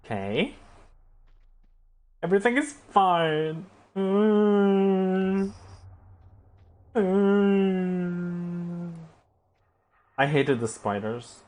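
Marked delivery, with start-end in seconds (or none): 2.2-8.9 higher, louder sing-song hum of fake calm; ironic everything is fine. Real, not acted. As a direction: pretend all is well through clenched calm. Sarcastic reassurance after trouble.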